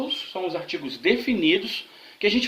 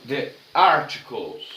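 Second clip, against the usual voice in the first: slow model English; 6 semitones lower, slower than usual. Shown as a demonstration. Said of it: "O segundo tópico da aula de hoje são os artigos".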